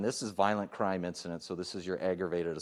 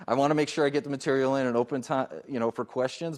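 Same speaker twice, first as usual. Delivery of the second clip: quick, louder and higher; rushed, raised-pitch explanation; talking fast; 5 semitones higher, 6 dB louder, faster than usual. Real, not acted. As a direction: hurry through to get the material in. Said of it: "I know I'm talking fast".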